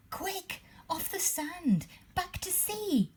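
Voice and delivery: high, urgent little snail cry; panicked high plea to hurry. A narrator's reading. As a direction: cry out to save the whale. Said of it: "cried the snail".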